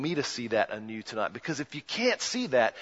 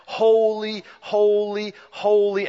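higher and louder, ringing out each holy; fervent worshipful proclamation; proclaimed holy; 7 semitones higher, 8 dB louder, slower than usual. Acted. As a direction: lift each holy up like a hymn of praise. Heavens crying holy round throne.

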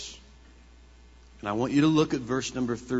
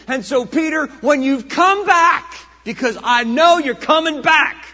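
loud, high, slowing pulpit climax; Jesus's promise to Peter, preached at full voice; full-force preaching; 12 semitones higher, 15 dB louder than usual. Acted. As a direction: proclaim it with full conviction.

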